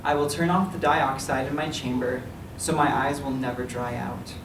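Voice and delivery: ordinary delivery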